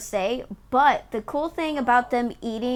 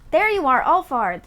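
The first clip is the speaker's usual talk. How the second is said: excited greeting; 5 semitones higher, 7 dB louder than usual. Acted. Greeting found companion.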